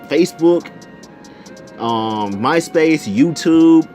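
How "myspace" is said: ordinary delivery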